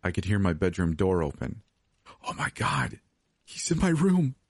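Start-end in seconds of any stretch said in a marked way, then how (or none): 2.3-4.4 horrified realisation, climbing sharply; terrified. A narrator's reading. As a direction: start hushed, then pitch up in alarm. Intruder entering her bedroom.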